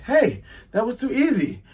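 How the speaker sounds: high exclaimed remark; Luffy. Acted. Captain reacts to the quick victory, high acted surprise. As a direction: start high on a loud "hey". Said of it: "Luffy's like".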